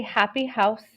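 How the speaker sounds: ordinary delivery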